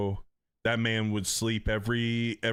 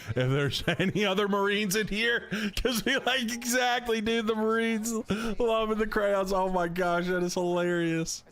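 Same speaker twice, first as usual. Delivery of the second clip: laughing excitement; 10 semitones higher than usual. Real, not acted. Laughing at Marines crayon joke.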